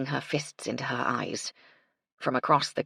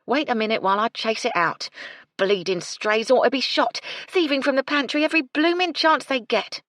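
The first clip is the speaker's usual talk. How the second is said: angry grumbling; 9 semitones higher, 5 dB louder than usual. A narrator's reading. Cook grumbles about stray cats.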